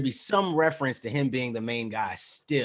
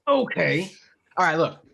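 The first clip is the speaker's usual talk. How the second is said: mock serious; 6 semitones higher, 5 dB louder than usual. Mock-serious start after teasing.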